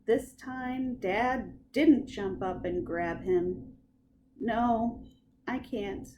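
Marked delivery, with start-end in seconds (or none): none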